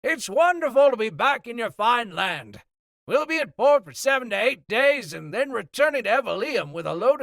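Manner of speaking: loud, high, booming captain over the noise; hearty sea captain. Acted. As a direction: project like a jovial captain on the docks. Captain voiced over wharf noise.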